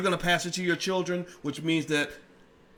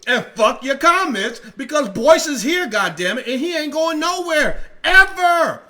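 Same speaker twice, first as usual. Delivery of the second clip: loud and high, louder to a yell on ever; slow, furious, fighting back at the trolls; real angry outburst; defiant shout; 8 semitones higher, 8 dB louder, slower than usual. Real, not acted. Defiant reply to mockers.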